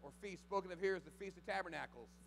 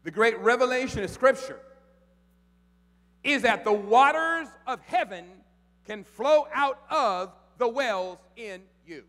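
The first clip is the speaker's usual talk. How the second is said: loud, higher, slow proclamation; full-force preaching; 7 semitones higher, 20 dB louder, slower than usual. Acted. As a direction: thunder it out to the congregation.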